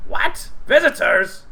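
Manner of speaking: high, loud surprised goblin; goblin leader. Acted. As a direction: cry out startled from inside. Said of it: "He says".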